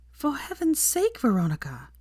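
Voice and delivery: shocked by her friend's thin dress; aghast. A narrator's reading. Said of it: "Hermione said aghast".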